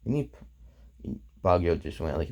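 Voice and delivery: plain reading voice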